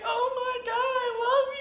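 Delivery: very high, teary squeak; mock tearful gushing. Retelling hugging her friend, high mock-crying gush. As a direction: wail love at a friend, hammed-up.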